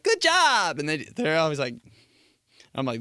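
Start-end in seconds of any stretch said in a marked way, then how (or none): 0.0-0.8 high chirpy good job; mock cheery praise. Imitating a high-fiver, high chirpy praise. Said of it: "when somebody's like".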